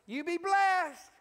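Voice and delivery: loud, high, drawn-out blessing; emphatic blessing. Shown as a demonstration. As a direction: call it out big and slow to a congregation. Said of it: "let's just practice a little bit".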